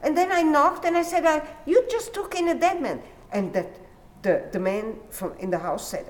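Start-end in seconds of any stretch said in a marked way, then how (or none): 0.0-3.0 high, quick, fretful retelling; hammed-up nervous storyteller, high and quick; praying man's anxious tale. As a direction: rush out the news of the dead man, pitched high.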